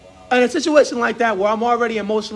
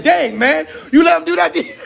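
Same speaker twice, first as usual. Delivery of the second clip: laughing pastor; 7 semitones higher than usual. Retold or imitated. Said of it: "Pastor said".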